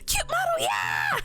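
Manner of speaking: high-pitched fangirl squeal; excited cheering. Acted. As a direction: squeal it like a hype girl. Acting out cheering other women on.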